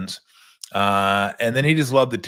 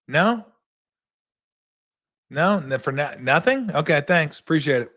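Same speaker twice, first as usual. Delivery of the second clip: higher, a touch of mock surprise; lightly hammed-up disbelieving coach; Nelly hearing no; 4 semitones higher than usual. Nelly hearing the GM turn down the trade, higher. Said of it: "He goes".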